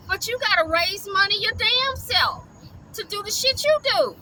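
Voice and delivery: high-pitched indignant rant; heated high rant. Real, not acted. Ranting at begging rescuers, high indignation. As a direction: let the indignation climb.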